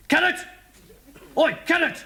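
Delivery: high, loud shout of the name; policeman shouting. Retold or imitated. Policeman shouts through the cell door, high and loud. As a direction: bellow the name down the cells. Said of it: "he's going".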